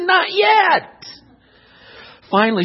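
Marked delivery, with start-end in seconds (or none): 0.0-1.1 high, sing-out denial; acted lady's raised-voice refusal; high-pitched refusal. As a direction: answer high, as the painting lady. Painting lady refuses the pleading.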